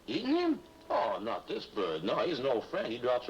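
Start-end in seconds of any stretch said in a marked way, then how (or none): 0.1-0.6 surprised. Acted. Shocked at eating the bird.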